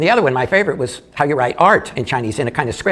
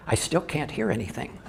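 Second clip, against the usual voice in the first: a soft, retold stage whisper, much softer; 10 dB quieter than usual. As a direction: murmur it like a theatre whisper, half laughing. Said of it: "in the stage whis-, whisper say".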